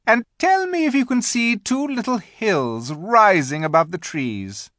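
high, loud, grand directions; commanding Queen. A narrator's reading. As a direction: point the way like an imperious queen.

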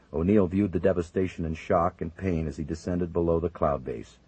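ordinary delivery, nothing marked

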